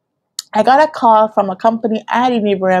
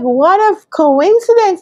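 amazed; 11 semitones higher, slower than usual. Retold or imitated. Amazed self-quote, high and slow.

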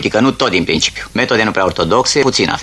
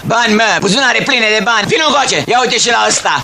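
high barked orders; raid shout. Acted. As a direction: push them around hard. Commissar bullies during raid.

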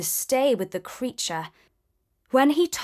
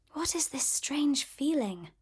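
whispered thought; 3 semitones higher, 8 dB quieter than usual. A narrator's reading. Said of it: "she thought".

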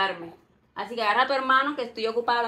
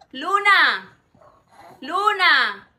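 loud call across the house; 4 semitones higher, 9 dB louder, much slower than usual. Acted. Nanny shouting for Luna to come. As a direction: yell the girl's name to fetch her.

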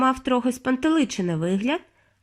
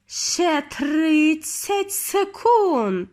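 very slow, higher witch call; 6 semitones higher, much slower than usual. A narrator's reading. Grand High Witch calls out the countdown to the witches, drawn out. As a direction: draw out each word gleefully. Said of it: "вигукнула верховна відьма".

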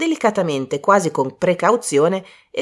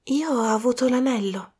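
high, clear voice; 7 semitones higher, 4 dB quieter than usual. Acted. Diana announces she got the ring, high and clear. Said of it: "disse Diana con voce alta e limpida".

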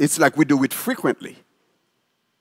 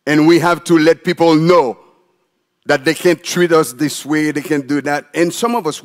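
usual speaking voice

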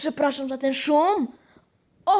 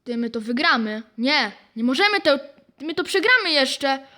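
ordinary talk

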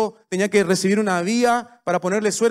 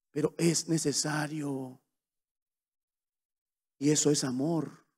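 softer, slower, tender; quiet and sincere; 6 dB quieter than usual. Acted. Preacher softly telling the church what love is, quiet. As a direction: let it land gently. Quiet turn after the plea.

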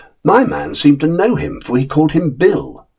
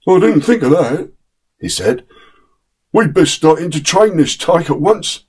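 higher, humbled voice; humble Bill; 5 semitones higher than usual. A narrator's reading. Bill humbly agreeing with the man. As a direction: concede sheepishly. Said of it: "He was quite humble".

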